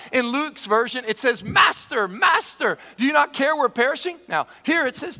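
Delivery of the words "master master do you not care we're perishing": high, urgent repeated call; acted yelling. Disciples try waking their master, raised urgent appeal. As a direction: call out over a storm. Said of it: "There must have been a lot of yelling".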